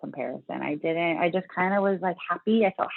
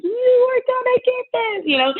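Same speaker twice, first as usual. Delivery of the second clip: stretched-out, sky-high excited cheer; excited friend; 6 dB louder than usual. Retold or imitated. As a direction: imitate a friend squealing with excitement. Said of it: "She's so excited".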